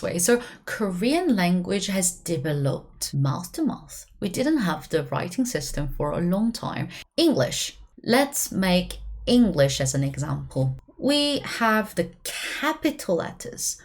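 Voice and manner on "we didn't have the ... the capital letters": normal talking voice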